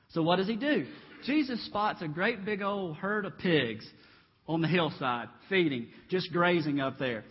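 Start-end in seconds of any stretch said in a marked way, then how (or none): none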